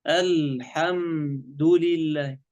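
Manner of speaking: "الحمد لله" very slow, l held without a bounce; still lam. Shown as a demonstration. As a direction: hold the ل steady in "الحمد لله". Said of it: "اللام ساكنة، ما في قلقلة".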